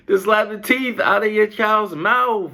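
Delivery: high, loud laugh through the words; cracking up while reading the line; laughter breaking into every word. Real, not acted. Laughing at a parent quote.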